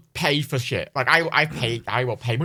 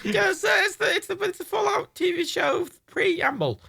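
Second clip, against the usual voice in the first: laughing. Real, not acted. Laughing through the answer.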